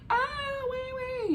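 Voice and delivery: very high mock newborn wail; imitated baby cry. Newborn daughter's first cry, imitated in a high wail. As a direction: squeal thinly like a crying newborn.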